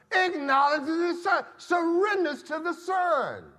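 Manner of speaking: loud, high, rising sermon cadence; fiery pulpit exhortation; preaching at full force. Acted. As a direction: drive it home to the congregation.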